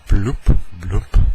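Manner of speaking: loud imitated bloop, rising; signature bloop noise. Showing listeners the signature sound, loud rising bloop. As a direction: punch out the bloop with an upward flip. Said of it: "That signature bloop".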